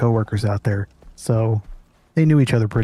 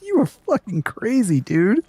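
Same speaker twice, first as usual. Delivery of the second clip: disbelief; 8 semitones higher than usual. Retold or imitated. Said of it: "We were just like".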